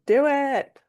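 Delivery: bright, lifted encouragement; excited cheer. Real, not acted. Host cheers on a guest fighting for licensure, excitedly. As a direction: cheer her on with a burst of energy.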